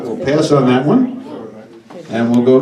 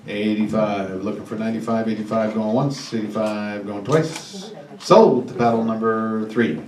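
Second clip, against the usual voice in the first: auctioneer calling; 3 semitones lower, 4 dB quieter than usual. Acted. Closing the bid, slow going once.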